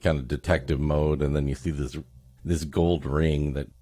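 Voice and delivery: ordinary delivery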